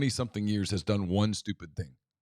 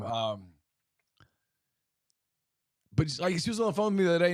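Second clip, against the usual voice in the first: ordinary delivery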